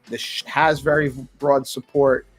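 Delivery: normal talking voice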